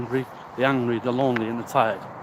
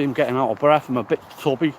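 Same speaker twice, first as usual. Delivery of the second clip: ordinary talk, nothing marked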